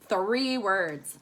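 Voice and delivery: usual speaking voice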